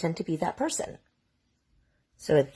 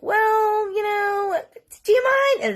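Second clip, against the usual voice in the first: much higher, louder mock cop voice, rising to a shout; mock pushy officer; 4 dB louder, slower than usual. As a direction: ham up a cop pressing him. Police pushing back at the man.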